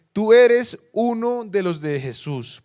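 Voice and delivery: acusación imitada, muy fuerte, aguda y lenta; acusación proclamada. Retold or imitated. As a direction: proclama cada palabra a toda voz. Dramatiza la acusación final. (acusación lenta a plena voz)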